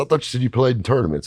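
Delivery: incredulous. Retold or imitated. Narrator confronting Peg.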